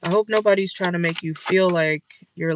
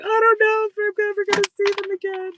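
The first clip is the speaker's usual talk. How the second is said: high, loud whiny wail; over-the-top fake weepy whine; mock crying whine; 14 semitones higher, 4 dB louder than usual. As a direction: ham up a sobbing lament. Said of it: "Stop being like".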